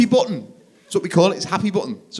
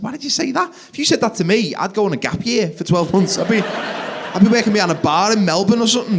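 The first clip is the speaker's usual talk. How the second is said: incredulous rant; 7 semitones higher, 5 dB louder than usual. Retold or imitated.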